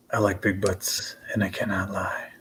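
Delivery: soft breathy whisper reading comments; hushed, unvoiced whisper. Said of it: "is what Wine Boy whispers".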